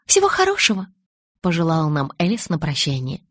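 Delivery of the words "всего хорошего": very high, loud goodbye; lilting, pitched-up send-off; cheery farewell. A narrator's reading. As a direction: sing out a parting wish. Alice wishes farewell.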